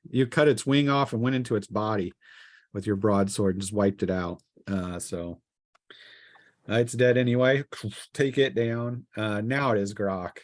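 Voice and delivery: normal talking voice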